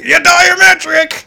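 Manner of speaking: loud, high, drawn-out confirmation; hammed-up shout back at him, slowly; mock yell. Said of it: "we'll just yell something".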